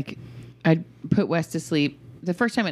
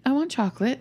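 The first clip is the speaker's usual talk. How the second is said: higher, lightly voiced little kid; pleading child; 7 semitones higher than usual. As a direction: imitate a child asking for a treat. Voices child asking for chocolate.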